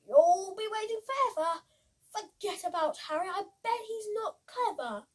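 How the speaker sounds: lightly voiced smug scarecrow; mocking boast. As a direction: taunt her, full of yourself. Boastful scarecrow mocks Betty.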